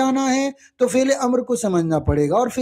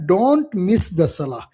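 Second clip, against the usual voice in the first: slow dictation; 3 semitones lower, much slower than usual. Shown as a demonstration. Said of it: "जुमला बन गया ना".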